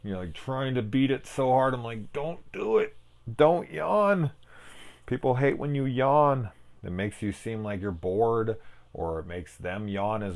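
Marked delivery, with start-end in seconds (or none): 2.1-4.3 high-pitched plea to himself; mock-desperate, high and strained self-talk; urgent self-pleading. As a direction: beg yourself to hold back, voice pitched way up. Acts out urging himself not yawn.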